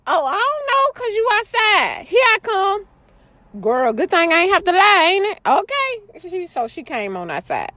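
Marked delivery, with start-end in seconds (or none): 0.1-2.9 put-on high, sing-song kid voice; high daughter voice. Daughter announcing she's coming outside, high and chirpy. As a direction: play the nosy daughter, up high. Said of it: "She was like".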